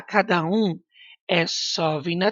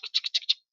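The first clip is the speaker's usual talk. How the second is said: ticking noise; 8 dB quieter than usual. Shown as a demonstration. Teacher mimics head ticking.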